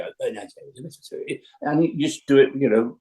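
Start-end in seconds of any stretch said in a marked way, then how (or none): 0.0-1.4 much softer, pleading Doctor; hushed, coaxing Doctor. Acted. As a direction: drop right down to coax Jamie back. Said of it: "Fraser was actually arguing with himself".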